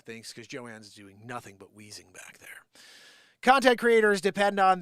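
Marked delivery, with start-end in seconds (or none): 0.0-2.6 whispered aside. Real, not acted. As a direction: whisper it off to the side. Aside about coworker off camera.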